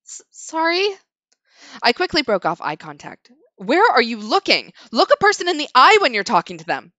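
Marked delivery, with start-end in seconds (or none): 0.1-0.9 timid stammer. Acted. Timid narrator apologizes. 3.6-6.9 scolding. Acted. Stern woman scolding a kneeling writer, loud.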